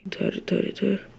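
whispered directions while sneaking; whispering. Real, not acted. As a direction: whisper it so granny won't hear. Sneaking past granny. (फुसफुसाते हुए)

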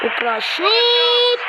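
excited commentator call. Acted.

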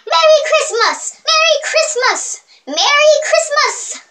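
high, drawn-out mocking Merry Christmas; mocked pronunciation. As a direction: Christmas stretched out in a high put-on voice. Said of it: "it's kinda weird, like".

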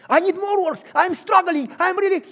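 high, loud frantic pleading; high loud struggling plea. Acted. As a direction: push the panic up.

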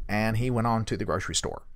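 usual speaking voice

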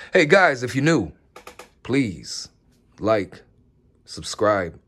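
loud, bright outro greeting; upbeat outro. Real, not acted. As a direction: hype up the viewers.

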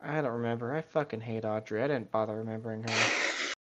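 ordinary delivery

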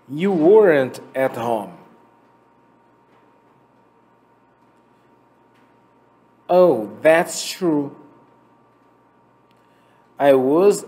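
slow, clear dialogue read for repeating; slow model reading. Shown as a demonstration. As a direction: read each line slowly for students to echo. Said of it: "vou ler o texto agora e você vai repetir". (leitura lenta e clara para repetir)

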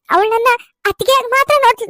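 ordinary delivery

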